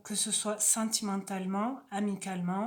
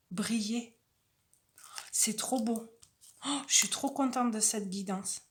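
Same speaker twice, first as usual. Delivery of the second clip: usual speaking voice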